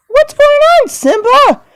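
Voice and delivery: very high, loud squeaky character voice; Nala. Pretend. Girlfriend asking Simba what's happening, high squeaky voice. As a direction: pipe up in a tiny high voice. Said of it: "This is my girlfriend, Nala".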